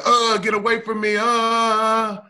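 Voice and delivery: imagined wife recoiling in disgust. Retold or imitated. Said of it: "she'd be like".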